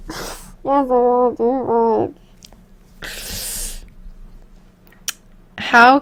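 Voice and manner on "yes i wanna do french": fading to whisper. Real, not acted. Nervously agreeing to French.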